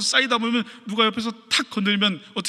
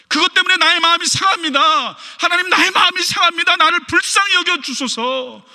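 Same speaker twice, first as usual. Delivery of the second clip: much higher, louder anguished prayer; raw prayer; 9 semitones higher, 5 dB louder than usual. Retold or imitated. A believer pouring out his heart to God, anguished cry. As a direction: pour out the hurt to God.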